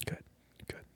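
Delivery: usual speaking voice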